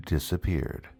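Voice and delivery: plain reading voice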